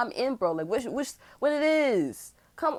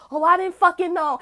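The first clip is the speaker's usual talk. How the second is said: high, loud mock-innocent whine; high whiny mock denial; 9 semitones higher, 6 dB louder than usual. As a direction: parody her playing dumb. Mocking her fake innocence.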